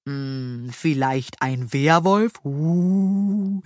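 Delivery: slow, mock-spooky teasing; playfully eerie, drawn out; joking. A narrator's reading. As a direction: tease with a ghostly howl at the end. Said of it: "witzelte Lille".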